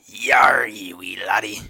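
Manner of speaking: loud, rough pirate call; mock pirate. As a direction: bellow like a hammed-up pirate. Jokey pirate bit after piracy remark.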